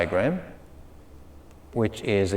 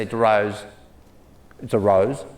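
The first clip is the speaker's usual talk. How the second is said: Italian Australian accent; 2 semitones lower than usual. Shown as a demonstration. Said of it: "if you're Italian Australian".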